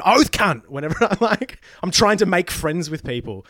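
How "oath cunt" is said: loud, high ocker Queensland exclamation; broad Queensland slang. Retold or imitated. Making friends overseas in broad Queensland. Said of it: "But it is like".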